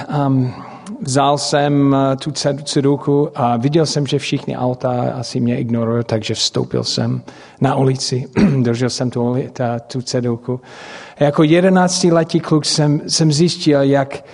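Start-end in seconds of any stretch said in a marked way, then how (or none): none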